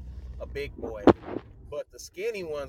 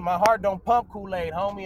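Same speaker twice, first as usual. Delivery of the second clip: loud, raised retelling of a challenge; defiant comeback; 5 semitones higher, 10 dB louder than usual. Retold or imitated.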